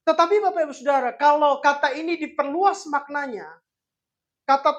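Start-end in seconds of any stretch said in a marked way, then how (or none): none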